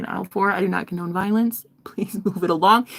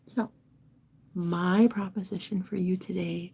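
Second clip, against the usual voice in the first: usual speaking voice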